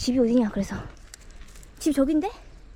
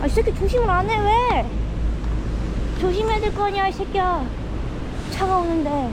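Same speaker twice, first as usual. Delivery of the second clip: scolding the dog; 7 semitones higher than usual. Real, not acted. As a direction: tell the dog off as a car comes. Scolding dog near car.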